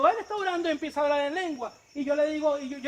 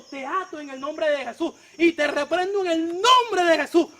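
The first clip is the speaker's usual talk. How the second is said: louder, slow commanding rebuke; forceful rebuke; 5 dB louder than usual. Retold or imitated. Preacher rebuking the electrician in Jesus' name, slow loud command. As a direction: bind him with slow loud authority.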